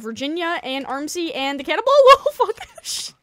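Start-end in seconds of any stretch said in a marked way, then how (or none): 1.9-3.1 high loud laughing curse; laughing scream. Real, not acted. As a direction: shriek while laughing.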